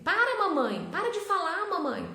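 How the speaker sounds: put-on high, loud childish protest; hammed-up kid shouting at mom; imitating a child's outburst. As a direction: mimic a little girl telling her mom to stop. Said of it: "Ela costuma me falar".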